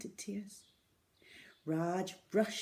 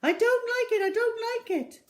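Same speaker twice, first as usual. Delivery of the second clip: very high, loud frightened plea; high loud panicked begging; pleading; 8 dB louder, faster than usual. A narrator's reading. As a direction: beg her, terrified. Raj terrified on counter.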